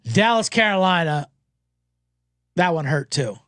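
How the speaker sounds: ordinary talk, nothing marked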